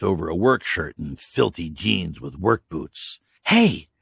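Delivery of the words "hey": sharp, high and loud. Acted. K J startling the groaning stranger, sharp and loud. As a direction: snap him awake with one word. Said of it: "K J said sharply".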